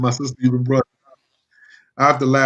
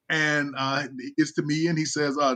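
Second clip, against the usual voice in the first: ordinary talk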